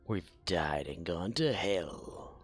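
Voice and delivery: whispered aside, slow. Real, not acted. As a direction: whisper it slowly to yourself. Whispered joke.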